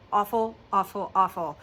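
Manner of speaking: normal talking voice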